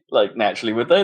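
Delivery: ordinary talk, nothing marked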